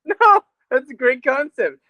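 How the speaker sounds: high, slow, giggling; laughing. Real, not acted. Teasing a friend about a name, giggling. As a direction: stretch it out while laughing.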